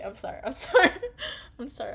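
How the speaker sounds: normal talking voice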